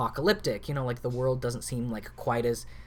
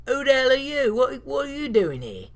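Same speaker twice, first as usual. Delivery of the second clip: loud, high, badly faked accent; a DM mangling a tough-guy accent; really bad accent; 11 semitones higher, 6 dB louder than usual. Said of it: "like, this really bad accent".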